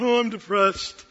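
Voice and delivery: hammed-up glum sigh of a line; put-on mopey complaint; mock moping. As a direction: imitate someone feeling sorry for himself. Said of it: "did I ever sit around going".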